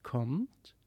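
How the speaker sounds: whispered come-along; a soft whispered invitation; beckoning. A narrator's reading. The strange child whispering to the children to follow.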